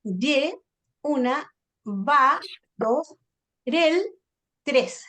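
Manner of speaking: each syllable set apart by pauses; deliberate tally, syllable then number; counting syllables. Shown as a demonstration. Teacher counting just one word for the class, syllable by syllable. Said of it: "voy a contar solo la palabra".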